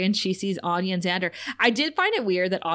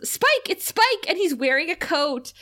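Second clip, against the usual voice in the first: much higher, excited robot Buffy; giddy robot; 8 semitones higher than usual. Retold or imitated. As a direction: announce it like a thrilled robot. S0 voices the Buffybot.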